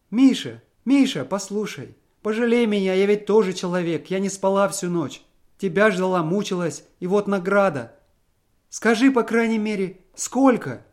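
high, loud tearful plea; wife begging to be pitied; anguished pleading. A narrator's reading. Wife pleads with Misha to tell how much, anguished.

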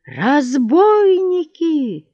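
high, long-drawn cry of alarm; wailed alarm. A narrator's reading. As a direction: wail it out slowly, high-pitched. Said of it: "завопил неожиданно купец".

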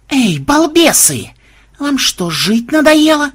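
high, drawn-out angry yell. A narrator's reading. Enraged vampire at the tower window to hunters, yelling. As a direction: holler down at them in outrage. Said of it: "он заорал".

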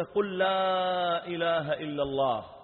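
sustained, insistent call; slow prompting. Retold or imitated. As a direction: draw the words out so he can repeat them. Retells prompting the dying man.

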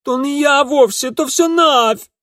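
high, loud screeching woman; shrill screeching bird woman. Acted. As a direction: screech like a frantic peasant woman. Cook shrieking defence.

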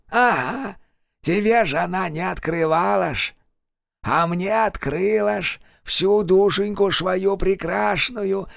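high, slow, otherworldly drawl; eerie possessed man. Acted. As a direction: sneer it like a possessed servant of a witch. Kravchuk's voice.

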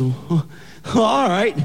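put-on high, awed boyfriend voice; a touch of thrilled disbelief; mock amazed boy. Boyfriend takes in the father's news, pleasantly amazed. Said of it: "He goes".

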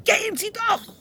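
very high, loud scream; shrieked command. Acted. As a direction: scream at them to get out. Shrieking at visitors to leave.

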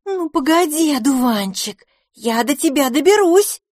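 high, loud furious threat; angry threat. A narrator's reading. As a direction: yell a sisterly threat. Pipina threatens her brother.

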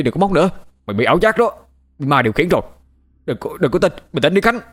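high, agitated warning; urgent pleading. A narrator's reading. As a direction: plead with a friend to snap out of it. Urging hallucinating friend to stop.

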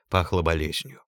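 usual speaking voice

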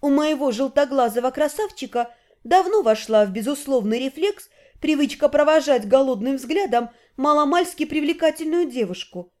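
usual speaking voice

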